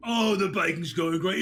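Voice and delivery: normal talking voice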